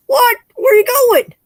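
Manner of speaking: high, loud call across the room; calling after her. Real, not acted. Calling after a friend who is walking away, loud and high. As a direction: shout after Carol as she leaves.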